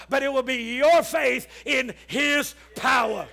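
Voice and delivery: loud, building sermon shout; full-force preaching. Acted. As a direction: drive it home to the congregation.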